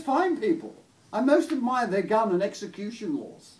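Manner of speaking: high, loud, posh English lady; Maggie Thatcher accent. Acted. Posh lady praising Texans to her visitor, shrill posh accent. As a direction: pronounce approval in a shrill upper-class accent. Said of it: "posh sort of Maggie Thatcher accent".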